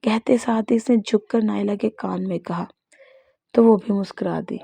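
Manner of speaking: ordinary delivery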